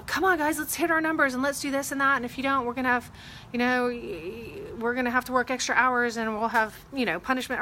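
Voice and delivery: hammed-up manager pushing the team to hit numbers; pushy boss pep talk. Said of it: "Is it to".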